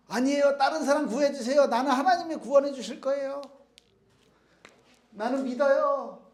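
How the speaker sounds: fervent refusal. Acted. Man refusing the helicopter, loud fervent insistence. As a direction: insist hard you'll be saved by God.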